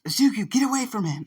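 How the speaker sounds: high, loud urgent call; yelled warning. Acted. Aizawa to his student near danger, yelling high. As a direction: shout a command across the fight. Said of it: "Aizawa yells".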